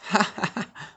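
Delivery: high ha ha ha laugh; girl laughing. A narrator's reading. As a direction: Ruth laughs at the dog planet idea. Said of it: "This made Ruth laugh".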